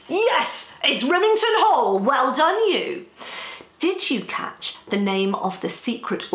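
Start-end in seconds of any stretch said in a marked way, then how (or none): 0.1-3.1 loud, high, cheering confirmation; delighted praise. Pretend. Host congratulates young spies on their answer, delighted. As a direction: cheer them on like they've cracked it.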